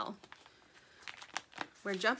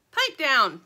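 snappy pipe down; 4 semitones higher, 10 dB louder than usual. Acted. Sugar snapping.

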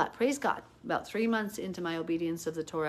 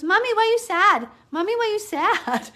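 put-on high, loud little-kid voice; small child; 14 semitones higher, 10 dB louder than usual. Three-year-old son asks his crying mother, high child voice. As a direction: imitate a toddler calling out to mommy. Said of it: "He goes".

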